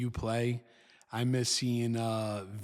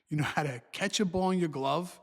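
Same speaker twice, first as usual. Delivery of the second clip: normal talking voice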